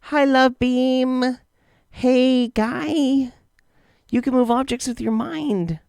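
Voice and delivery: very high, drawn-out put-on voice; high-pitched character voice, held notes; Anna Wood. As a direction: greet them in a high, stretched impression. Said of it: "Anna Wood, yeah".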